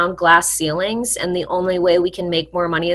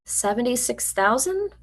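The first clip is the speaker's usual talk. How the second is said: softer, doubtful, rising at the end; wary question; 4 semitones higher, 8 dB quieter than usual. Shown as a demonstration. After a pause, echoing the recruiter's offer, softly wary. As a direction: echo the figure back like you don't trust it. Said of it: "And then you pause".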